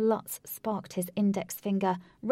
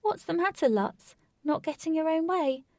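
taunting; 8 semitones higher, 4 dB louder than usual. Acted. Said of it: "poking the word knife further in".

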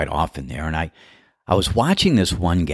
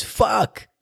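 much higher mock curse; frustrated curse. Golfer curses his shanked shot, high mock voice. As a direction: spit it out after a bad shot. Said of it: "and says".

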